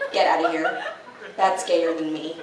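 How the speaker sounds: ordinary delivery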